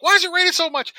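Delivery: high, loud disbelieving wail; exasperated outcry. Retold or imitated. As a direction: throw your hands up at the sky. Texans complaining about flash floods.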